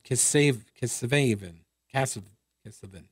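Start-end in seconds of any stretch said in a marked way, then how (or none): none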